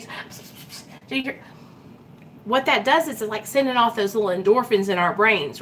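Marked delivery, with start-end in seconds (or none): 0.0-1.4 breathy psst sounds, fading to a hushed question; gossip whisper. Retold or imitated. Gossiper passing a rumour on, whispered. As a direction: spread the rumour in secret. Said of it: "you go over here and you".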